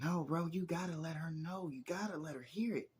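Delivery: high-pitched imitation of an insistent kid; put-on high voice, egging someone on; urging younger cousin. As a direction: plead like an eager little cousin. Acts out cousin urging him.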